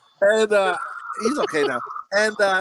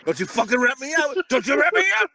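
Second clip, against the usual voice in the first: higher-pitched mock threat, rising on the repeat; mock warning; 8 semitones higher than usual. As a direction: playfully warn a snitch. Mock threat to dog amid laughter.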